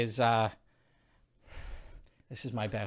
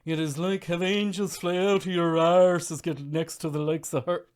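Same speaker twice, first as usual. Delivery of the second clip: stage-Irish charmer, pitched up; mock Irish banter merchant; 4 semitones higher, 5 dB louder than usual. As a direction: lay on a loud, lilting Irish brogue. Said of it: "the Irish banter merchant".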